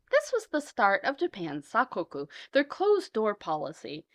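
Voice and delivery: ordinary delivery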